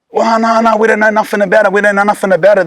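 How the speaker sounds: put-on mild panicky chorus of kids, pleading innocence; scared kids denying. Classmates facing a knife, nervously insisting they know nothing.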